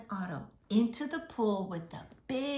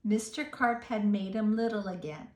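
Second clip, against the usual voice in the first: ordinary delivery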